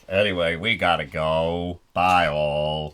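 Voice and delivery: very low, lazy goodbye; drawling mascot. Pretend. Mascot signing off after show, low drawl. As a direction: sink deep and drawl the farewell.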